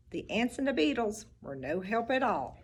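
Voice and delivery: ordinary delivery, nothing marked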